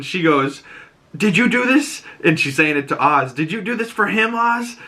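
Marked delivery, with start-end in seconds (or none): 1.1-2.0 high-pitched retold accusing question; shocked accusation. Sophia to Oz as she gets arrested, shocked accusation. 3.3-4.8 high, rising question in mock disbelief; shocked accusation. Sophia asking Oz about the reporter's murder, shocked accusation.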